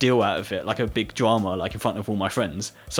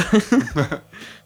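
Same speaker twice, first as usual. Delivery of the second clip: laugh; 7 semitones higher, 6 dB louder than usual. Real, not acted. Laughing after the teacher's reversal.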